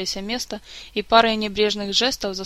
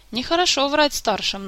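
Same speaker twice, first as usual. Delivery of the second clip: admonishing; 6 semitones higher, 3 dB louder than usual. A narrator's reading. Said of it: "наставительно сказала Марго".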